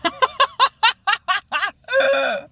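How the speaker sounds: long, high self-amused laugh; high giggle. Real, not acted. Vlogger laughs at her own ranty show, high giggle.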